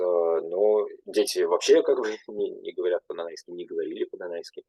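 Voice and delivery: ordinary talk, nothing marked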